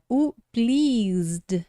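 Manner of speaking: pleased as one syllable, silent second e; pronúncia de pleased. Shown as a demonstration. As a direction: draw out pleased, ending in zd. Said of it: "a gente ignora de novo". (pleased com E mudo, final zd)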